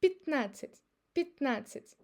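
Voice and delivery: Пятнадцать as pit-NA-tsit; reduced unstressed Я. Shown as a demonstration. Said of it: "it's again pronounced as И".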